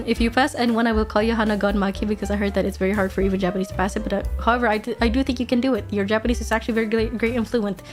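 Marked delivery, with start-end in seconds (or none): none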